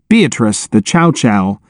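usual speaking voice

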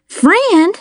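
high-pitched, incredulous echo; acted shock without added volume; gasped surprise. A narrator's reading. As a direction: blurt the word high, taken aback. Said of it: "I gasped".

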